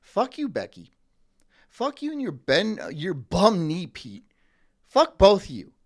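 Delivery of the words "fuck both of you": much louder, snapping; loud hammed-up fury; mock angry outburst. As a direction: tell them both off. Telling off imagined coworkers.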